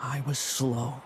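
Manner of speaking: low, hushed admission of weakness; dejected, halting and soft; bitter self-reproach. Acted. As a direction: sink low and quiet, word by heavy word. Bitterly admits his own weakness.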